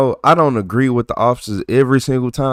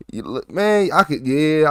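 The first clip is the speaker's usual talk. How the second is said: ordinary delivery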